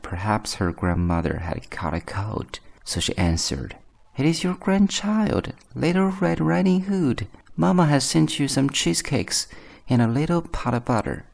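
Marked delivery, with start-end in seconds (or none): 4.2-11.2 put-on very high girlish voice, settling slightly by the end; little girl. A narrator's reading. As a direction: pitch it high as a young child.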